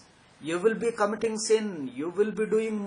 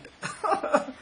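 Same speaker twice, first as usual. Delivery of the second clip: breathy laugh; 10 semitones higher than usual. Real, not acted. Laughs after "sincerely I learned.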